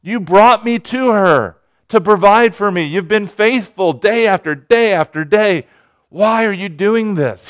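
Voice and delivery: high, pleading cry to God; anguished plea. Acted. Elijah crying out to God over the dead son, high and anguished. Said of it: "You can hear him saying the same question".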